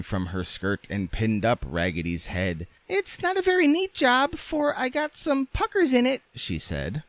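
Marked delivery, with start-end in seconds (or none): 2.9-6.2 extremely high, squeaky doll; French doll voice. A narrator's reading. French doll inspecting her friend's head, squeaky voice. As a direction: pipe it up in falsetto.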